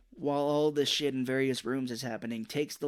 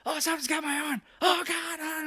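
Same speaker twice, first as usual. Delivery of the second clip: high-pitched pretend terror; mock panic; 4 dB louder than usual. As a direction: act out being grabbed, hammed-up. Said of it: "she does the whole thing of".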